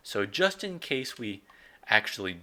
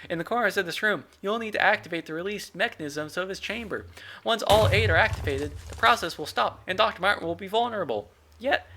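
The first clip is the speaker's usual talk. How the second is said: intern Steven voice; 10 semitones higher than usual. Acted. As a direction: yell it up high like an eager intern. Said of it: "intern Steven's voice".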